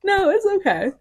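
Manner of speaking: high, loud laughing reassurance; kind gentle laugh. Real, not acted. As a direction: laugh it off kindly. Reassuring friend after laughing.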